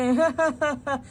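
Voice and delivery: a short amused laugh; cheeky laugh at the accusation. Acted. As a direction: laugh at the mix-up. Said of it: "हंसो कम तुम".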